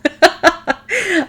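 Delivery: real laugh, high and bright; high burst of laughter. Real, not acted. Mom recalling her daughter's words, real laughter. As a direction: laugh out loud in disbelief.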